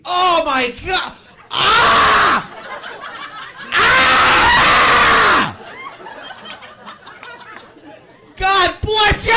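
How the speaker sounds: joy screamed as if in agony; very high, loud pained wailing congratulations; Jerry. Retold or imitated. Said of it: "sound like they're in pain also".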